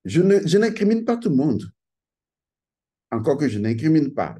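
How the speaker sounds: ordinary talk, nothing marked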